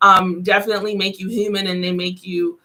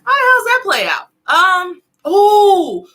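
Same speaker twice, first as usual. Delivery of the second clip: high, louder disbelief; surprised; 9 semitones higher, 6 dB louder, slower than usual. Real, not acted. Reacting in surprise to the card for viewers. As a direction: react like the card shocked you.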